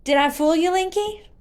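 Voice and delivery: put-on high, loud little girl tease; little girl teasing Link about her trick; gleeful teasing. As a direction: gloat playfully.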